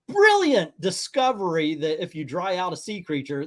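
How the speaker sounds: ordinary delivery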